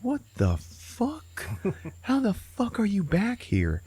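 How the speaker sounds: very high, drawn-out disbelief; retold astonishment. Frank seeing Barry back, astonished. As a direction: stretch each word in high mock shock.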